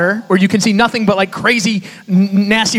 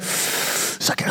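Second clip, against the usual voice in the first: breathless whisper; 12 dB quieter than usual. Retold or imitated. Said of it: "I'm like".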